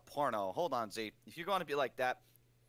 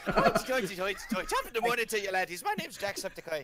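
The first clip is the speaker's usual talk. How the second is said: laughing, higher, louder Irish greeting; Jacksepticeye Irish impression; 5 semitones higher, 5 dB louder than usual. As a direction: have a first go at an Irish YouTuber intro. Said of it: "How are you utterly going Irish?".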